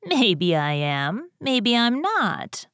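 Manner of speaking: slow, lightly mischievous singsong; teasing, amused. A narrator's reading. Jack dodges the wife's suspicion, teasing. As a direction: draw it out playfully, keep them guessing. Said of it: "said Jack with a laugh".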